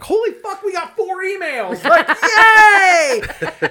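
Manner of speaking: very high, squealing with laughter; giddy excitement. Retold or imitated. Recalling the first listener emails, squealing and laughing. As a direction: shriek it like a thrilled kid. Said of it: "initially it was like".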